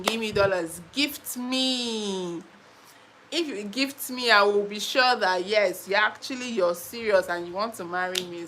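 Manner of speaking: ordinary talk, nothing marked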